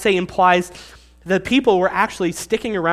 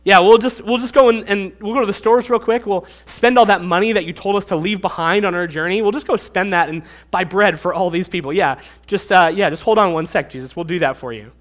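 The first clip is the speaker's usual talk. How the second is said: louder, eye-rolling mock agreement; sarcastic; 4 dB louder than usual. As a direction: brush him off with fake eagerness. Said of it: "The tone is almost sarcastic".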